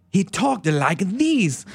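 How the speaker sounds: put-on high, slow, effusive Italian accent; hammed-up Italian accent. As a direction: stretch it out in an excitable Italian accent. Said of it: "almost like Roberto Benigni accepting an award".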